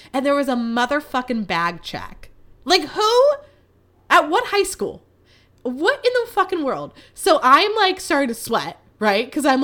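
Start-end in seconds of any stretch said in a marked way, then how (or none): 2.6-6.9 incredulous outburst. Real, not acted. Outraged at a school bag check.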